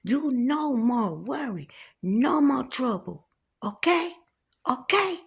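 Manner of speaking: high, insistent, rising okays; cook's insistence. Retold or imitated. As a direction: press her for agreement. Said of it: "He said".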